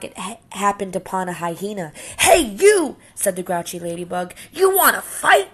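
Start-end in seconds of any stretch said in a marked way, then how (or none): 2.2-3.0 high, loud shout at a stranger; bully's call. Acted. Grouchy ladybug picks fight. 4.5-5.5 high, loud taunt with a rising end; bully's dare. Acted. Grouchy ladybug picks fight.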